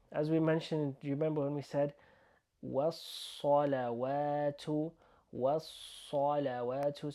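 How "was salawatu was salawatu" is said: "was-salawatu" with a dark, rounded "saw"; slow "was-salawatu", long hissed double s; heavy sa sound. Shown as a demonstration. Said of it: "So that sa sound".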